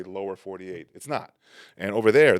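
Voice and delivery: normal talking voice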